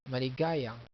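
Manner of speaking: maligayang with GA stressed; "maligayang", strong GA, falling close. Shown as a demonstration. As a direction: punch the third syllable, mali-GA-yang. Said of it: "The accent is here".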